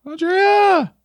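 high, loud call of her name. Real, not acted. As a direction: shout Andrea across the room. Calling out to lost player.

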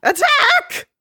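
very high, loud shrieked order; battle cry. Retold or imitated. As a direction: scream the charge like a villain. Villain ordering army.

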